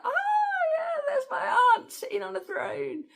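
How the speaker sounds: very high, delighted mock voice; giddy mock gasp. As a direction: marvel at your aunt on the throne. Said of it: "It felt like".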